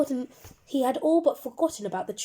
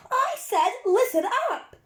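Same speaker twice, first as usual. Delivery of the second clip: much higher, booming teacher's bellow; 13 semitones higher, 7 dB louder than usual. A narrator's reading. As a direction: bellow to silence a hyped-up class. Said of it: "bellowed, and there was silence".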